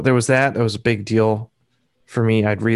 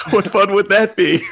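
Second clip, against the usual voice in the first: laughing; 10 semitones higher, 4 dB louder than usual. Retold or imitated. Joking retelling with Rick.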